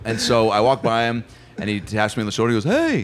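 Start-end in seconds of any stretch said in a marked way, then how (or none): 2.6-3.0 high-pitched, lilting star impression; high mimicked celebrity voice. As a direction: greet in a high put-on voice. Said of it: "Paul McCartney's here".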